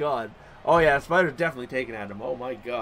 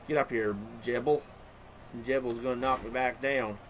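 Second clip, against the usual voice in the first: low, soft put-on mumble; put-on talking voice; 5 semitones lower, 8 dB quieter than usual. Gamer narrating his match, low silly put-on voice. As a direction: drop into an odd low, quiet voice. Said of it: "I started talking like this".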